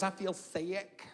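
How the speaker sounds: "sick" stretched and faint; quiet, drawn out, feeble; weak, slow, faint. Retold or imitated. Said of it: "She goes".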